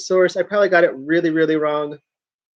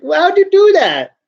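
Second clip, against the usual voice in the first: much higher, louder, asking the cat in disbelief; amazed question; 12 semitones higher, 7 dB louder than usual. Retold or imitated. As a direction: squeal it like the cat just did magic. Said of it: "I'm like".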